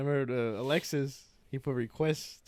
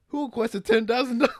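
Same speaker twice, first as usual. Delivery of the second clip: higher, louder, disbelieving; shocked question; 9 semitones higher, 8 dB louder than usual. Retold or imitated. Coworker seeing a ten grand request, shocked. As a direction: ask it in total disbelief.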